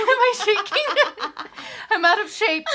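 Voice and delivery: high, loud laughing while trembling. Real, not acted. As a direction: laugh it out, legs shaking. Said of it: "are you trembling".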